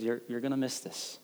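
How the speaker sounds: gently admonish, almost under the breath; quiet reminder. Retold or imitated. Wife reminding her complaining husband, quiet and gentle.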